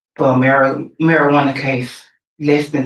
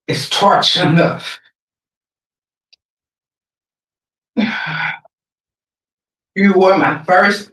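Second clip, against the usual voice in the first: emotional; 3 semitones higher than usual. Real, not acted. Victim's sister addresses killer.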